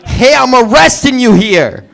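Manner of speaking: put-on brash New York accent shout; New York cop. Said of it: "a police officer from New York".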